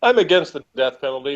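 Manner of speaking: ordinary delivery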